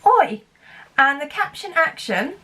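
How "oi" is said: high, loud oi. Shown as a demonstration. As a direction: call it out high and bright. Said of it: "Can you say oi with me?".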